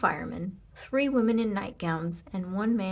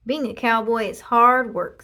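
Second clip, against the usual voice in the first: proud cowboy Leroy; 3 semitones higher, 10 dB louder than usual. A narrator's reading. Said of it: "said Leroy Ninker".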